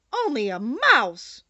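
loud, drawn-out indignation; offended little creature exclaiming loudly; indignant cry. A narrator's reading. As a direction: cry it out slowly, deeply affronted. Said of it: "cried the little animal indignantly".

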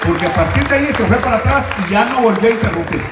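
loud, fast fervent preaching; fast, loud preaching to the church; preaching fervor. Real, not acted. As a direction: rush it out with fire. Preacher exclaiming the testimony.

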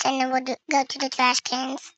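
ordinary talk, nothing marked

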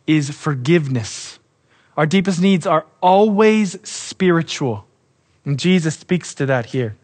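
normal talking voice

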